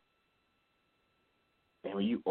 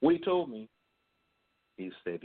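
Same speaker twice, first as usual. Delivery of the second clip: ordinary talk, nothing marked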